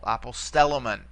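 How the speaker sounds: aposTELlomen, accented third syllable from the end; stress on antepenult. Shown as a demonstration. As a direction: hit the stel in apostellomen. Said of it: "bring it back all the way to the antepenult".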